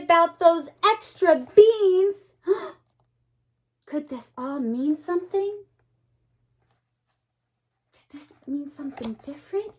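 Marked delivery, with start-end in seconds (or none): 2.4-5.6 gasp, then ask it softly; a little gasp of worry, then wonder aloud; storyteller's hushed gasp; suspenseful gasp. Pretend. Reader gasps in suspense. 7.9-9.7 fast, hushed whisper; suspenseful storybook whisper. Pretend.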